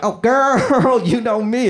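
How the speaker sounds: put-on high, sassy "Oh, girl". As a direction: camp it up as a flamboyant guy.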